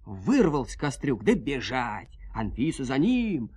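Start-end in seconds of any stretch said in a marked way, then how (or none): none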